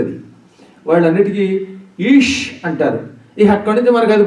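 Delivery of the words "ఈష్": ఈష్ cut off on a hushing sh; Hindi speakers' pronunciation. Retold or imitated. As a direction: drop the final vowel, ending ఈష్ on a thick sh. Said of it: "Hindi వాళ్ళకు వచ్చిన పెద్ద ఇబ్బంది".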